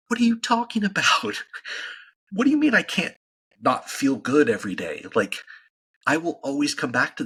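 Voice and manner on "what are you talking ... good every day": incredulous disbelief. Real, not acted. Podcast host to critics who call his diet restrictive, incredulous high squeak. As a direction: pitch jumps way up in astonished disbelief.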